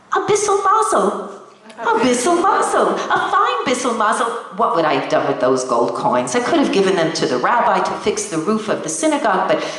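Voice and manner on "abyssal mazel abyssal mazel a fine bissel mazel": delighted exclaim. Acted. Old woman delighted at pennies.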